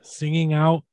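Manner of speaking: slow dramatic recital with long pauses; Shatner poetry slam. Acted. Host reads lyrics as a poetry slam for guessing, halting Shatner-style recital. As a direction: lyrics spoken as halting, weighty verse. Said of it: "Shatner's Poetry Slam".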